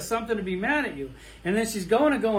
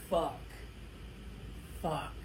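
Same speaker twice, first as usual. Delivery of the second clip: "Fuck" dropped much softer under the breath; muttered curse; 3 semitones lower, 12 dB quieter than usual. Retold or imitated. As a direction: swallow the swear, restraining anger. Holding back anger at worker.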